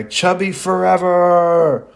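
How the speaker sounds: high, loud, slow battle cry to the sky; triumphant yell. A narrator's reading. Choji flexing at the sky, triumphant battle yell. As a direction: bellow it skyward while flexing. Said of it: "flexes and yells to the sky".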